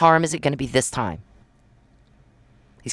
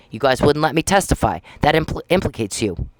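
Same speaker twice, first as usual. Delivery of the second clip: usual speaking voice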